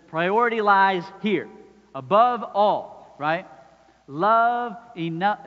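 a preacher building to a forceful climax; full-force preaching. Acted. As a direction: drive it home to the congregation.

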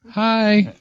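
high, held-out little "hi"; tiny mock on-air hello; mimicked radio voice. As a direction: imitate a shy guest's high radio greeting. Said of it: "That was a radio voice right there".

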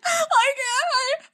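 high, choked I can't; half-sobbed retold cry; tearful. Remembering crying in the car, high tearful quote. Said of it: "I was like".

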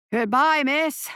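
shopkeeper calling after her; calling out goodbye. Acted. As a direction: call it after someone leaving. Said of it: "called the shopkeeper".

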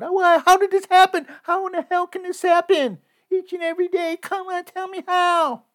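very high, mocking falsetto of the grieving woman; mocking whiny woman. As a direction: mimic her fake outrage at the podium, high and shrill. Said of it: "be behind the podium right now going".

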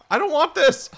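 high, loud panicked cry; panicked yell. Acted. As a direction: shout it in panic. NPC refusing the baby.

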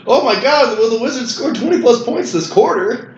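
hammed-up eager gambler; mock excitement. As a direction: act thrilled over the game, teasingly. Imitating someone hooked on game predictions.

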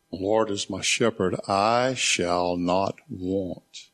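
"I shall not want" very slow, word by word; quick first clause, then weigh each word of "I shall not want"; drawn-out "I", "shall", "not", "want"; stress on I SHALL NOT WANT. Shown as a demonstration. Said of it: "I emphasized each one of those words".

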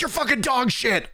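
a squeaky, high-pitched mock yell after a round; mock scream. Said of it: "screaming after a round".

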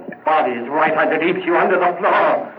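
high shouted admission of murder; frenzied confession. Acted. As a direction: pour out the guilt, unhinged.

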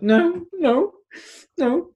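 high squeaky protest, imitating his younger self; mock denial. Caught after crying, denying it to the director in a high squeak. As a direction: squeak out the denial, pretending nothing is wrong.

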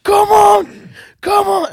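high, slow shouted hype with laughter. Retold or imitated. DJ on the God mic hyping the crowd, laughing shout. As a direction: hype the room like a club DJ, pitch way up. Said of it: "I'd be like".

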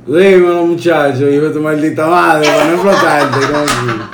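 loud Dominican Spanish accent. Shown as a demonstration. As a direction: yell it like a Dominican. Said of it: "All right, now do Dominican".

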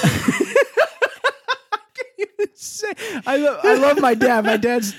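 high, slow, breaking into laughter; giggling helplessly at own story; laughing. Real, not acted. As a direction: laugh through the words. Cracking up mid-story.

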